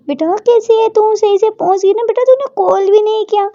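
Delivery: a father thrilled to see his daughter; eager father. Acted.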